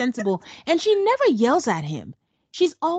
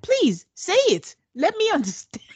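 pleading quote; 9 semitones higher than usual. Retold or imitated. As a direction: coax him to speak, mock-earnest. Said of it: "Bao was like".

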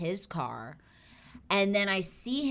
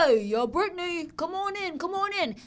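much higher, hammed-up Guido greeting; Guido doctor; 10 semitones higher than usual. Imitating the Guido gynecologist welcoming her, high hammed-up. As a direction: play the slick Guido calling her in. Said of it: "just imagine the most Guido guy".

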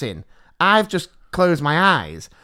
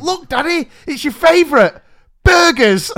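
put-on child; 11 semitones higher, 6 dB louder than usual. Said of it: "She went".

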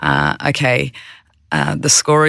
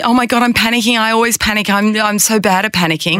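higher, quick mock panic, half-hearted; mock panicky self-talk; 7 semitones higher, faster than usual. Mimicking a presenter's inner panic before a meeting, higher mock fret. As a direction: voice the panicking inner critic, lightly hammed. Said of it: "Not judgmentally".

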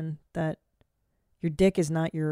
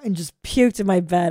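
ordinary delivery

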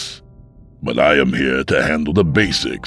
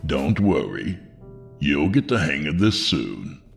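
very deep, unhurried voice; calm reassurance; slower than usual. A narrator's reading. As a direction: reassure calmly from a deep register. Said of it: "in a surprisingly calm voice".

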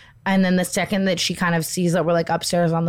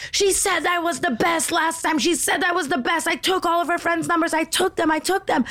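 mock screaming nurse; 10 semitones higher than usual. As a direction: pitch it way up and drag it like a frantic nurse. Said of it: "she's just screaming at Chris".

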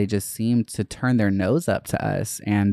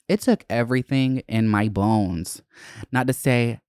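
ordinary talk

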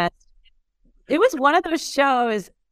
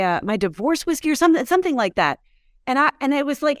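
usual speaking voice

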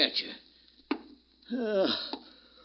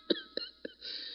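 weak wheezy cough; 12 dB quieter than usual. Acted. Sick old man coughing.

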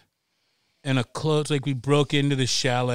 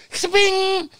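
high, loud cartoon "sping"; zip sound effect; 5 dB louder than usual. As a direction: take off like a cartoon. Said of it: "So I go".

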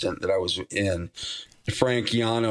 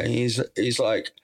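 ordinary delivery

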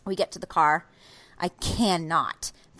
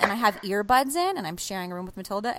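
usual speaking voice

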